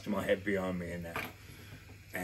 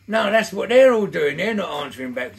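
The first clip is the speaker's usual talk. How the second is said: loud and much higher, working himself up; a raised, excited inner voice; fired-up thought; 8 semitones higher, 12 dB louder than usual. Retold or imitated. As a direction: shout the thought as you decide to act. Inner thought before the punch.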